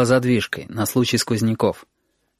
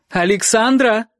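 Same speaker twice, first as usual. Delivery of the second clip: медленно, высоко, полным именем; позови строго и испуганно; гневный окрик; 11 semitones higher, slower than usual. Acted. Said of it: "в её голосе был гнев и страх". (мама грозно зовёт дочь)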